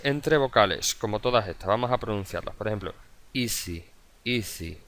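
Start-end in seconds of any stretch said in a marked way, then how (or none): 3.3-4.8 "easy" with a buzzing Z between vowels, soft; S sonora. Shown as a demonstration. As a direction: model "easy" voicing the S. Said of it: "Vemos que vibra ahí esa". ("easy" con la ese vibrada entre vocales)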